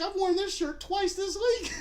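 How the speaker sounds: high, slow, carefree voice, then a laugh; clueless kid. Retold or imitated. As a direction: play him totally unbothered. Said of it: "He's like".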